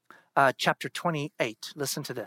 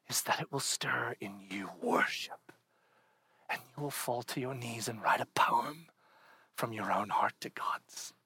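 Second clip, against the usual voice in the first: hushed intimate preaching; 5 semitones lower, 5 dB quieter than usual. Acted. Soft whispered exhortation.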